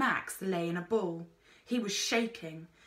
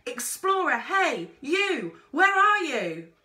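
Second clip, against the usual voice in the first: high, loud desperate shout; frantic calling out; 8 semitones higher, 8 dB louder, slower than usual. A narrator's reading. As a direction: yell for the explorer while running. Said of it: "shouting as she ran".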